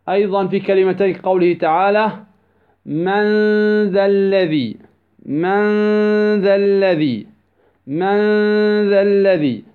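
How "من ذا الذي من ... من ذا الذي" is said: من ذا الذي with the n of من held as a hum; ikhfa with ghunnah. Shown as a demonstration. Tajweed teacher gives an example, long humming ikhfa in من ذا الذي. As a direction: stretch a hidden nasal noon into the dhal of من ذا الذي. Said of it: "والإخفاء".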